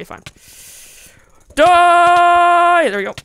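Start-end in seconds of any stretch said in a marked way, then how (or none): 1.5-2.9 high, loud battle yell. Real, not acted. Gamer screaming at an enemy he is attacking, a loud yell.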